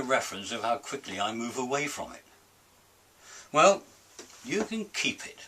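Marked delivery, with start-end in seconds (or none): 3.5-5.4 grumpy dismissal. Acted. Dismissive closing outburst.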